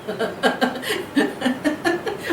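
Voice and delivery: soft giggle bursting out; can't hold back a giggle; breathy laugh remembering the scene; real laugh. Real, not acted. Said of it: "मेरे को अभी बहुत हंसी आ रही".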